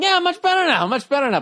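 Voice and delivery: high-pitched mocking imitation of the comedian; mock reassurance. Host teasing the comic about being calmer now, mocking reassurance. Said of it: "He's doing this whole thing".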